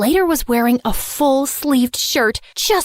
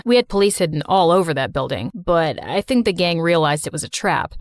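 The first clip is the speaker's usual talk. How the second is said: put-on deep, steady young man; calm her down in a low voice; low reassuring male voice; 7 semitones lower than usual. Slater explains.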